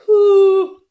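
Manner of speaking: high, loud half-laughing, half-crying whew. Real, not acted. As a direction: blow it out in relief and pain. Finishing garlic, tear coming.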